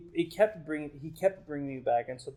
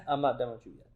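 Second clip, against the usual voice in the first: amused laugh running into the words; chuckling, unbothered comeback; God; 11 dB louder, faster than usual. Retold or imitated. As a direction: laugh it off, knowing better. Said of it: "And God's just like".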